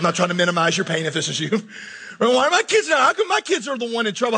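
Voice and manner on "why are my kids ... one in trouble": high, louder mock complaint; exasperated parent. Pastor voices a parent asking God about troubled kids, exasperated. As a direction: plead like a worried parent.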